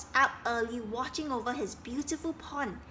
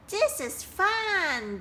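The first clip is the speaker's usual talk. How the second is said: high, slow happy buzz; 8 semitones higher, 4 dB louder, much slower than usual. Acted. Dragonflies playing without Duck, high buzz. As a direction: sing it out playfully. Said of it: "buzzed the dragonflies".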